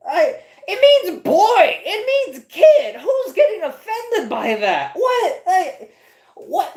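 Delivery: laughing disbelief. Real, not acted. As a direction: stretch it out, laughing at how absurd it is. Disbelief at the article.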